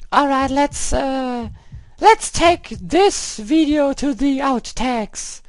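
put-on a falsetto girl persona ranting in English at the game. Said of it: "I'm playing the English girl right now".

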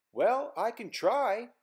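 a breezy spirit answering slowly; wind voice. Acted. The wind agreeing to try, slow and high. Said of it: "replied the wind".

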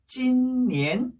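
"찐니엔" with long level "찐", then rising "니엔"; first-second tone. Shown as a demonstration. Teacher joining the syllables, "찐니엔" level then rising. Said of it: "일 성, 이 성일 때는".

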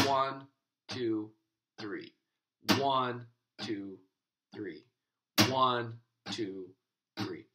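"One" accented, "two" lighter, "three" dropping away; counting aloud with "One" punched; stress on beat one. Shown as a demonstration. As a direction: lean on each "One" and let "three" fall off. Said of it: "emphasizing beat one each time".

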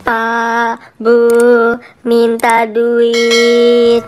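drawn-out, droning beggar's call; beggar's plea. Acted. Beggar pleading with passers-by for money, drawn-out droning plea. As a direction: beg slowly, stretching out each word. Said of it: "kasihan deh pengemis itu".

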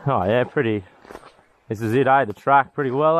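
normal talking voice

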